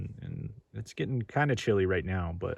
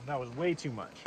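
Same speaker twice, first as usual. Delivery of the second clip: usual speaking voice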